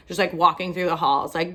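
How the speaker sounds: ordinary talk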